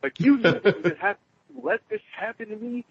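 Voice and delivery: high, indignant accusation; pissed off. Retold or imitated. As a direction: retell it as an aggrieved protest. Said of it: "it pissed me off 'cause I'm like".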